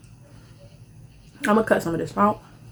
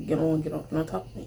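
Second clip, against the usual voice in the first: low murmur; 4 semitones lower, 8 dB quieter, faster than usual. Retold or imitated. Quoting him low.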